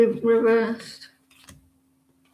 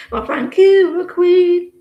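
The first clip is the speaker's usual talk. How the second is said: high, loud burst of mock-flattered thanks; delighted thanks; 6 semitones higher, 6 dB louder, slower than usual. Real, not acted. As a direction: accept the title with a loud, high squeal of delight. Reacting to being called Queen.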